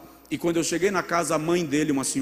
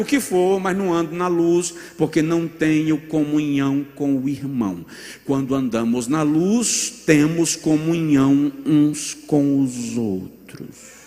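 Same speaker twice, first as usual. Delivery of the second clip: louder, slowing preaching voice; rising preaching; 5 dB louder than usual. Acted. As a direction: lean into it, slowing on the key line. Preacher building to a point.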